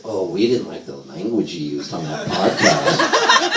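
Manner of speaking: low, slow maternal reproach; disapproving. Retold or imitated. Mom mad about podcast language, slow disapproval. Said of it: "they were mad at me".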